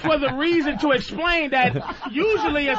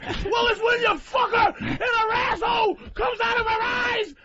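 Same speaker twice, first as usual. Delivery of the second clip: heckler shouting; 10 semitones higher than usual. Retold or imitated.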